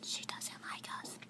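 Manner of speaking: hushed classroom whisper; secretive aside during class. Real, not acted. Students whisper about teacher during class.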